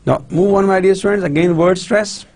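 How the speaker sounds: ordinary delivery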